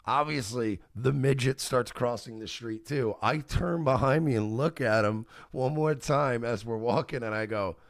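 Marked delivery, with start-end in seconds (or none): none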